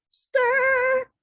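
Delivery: high bright stirring sound; high stir effect. Acted. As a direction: sing out the mixer noise. Mixer sound effect word.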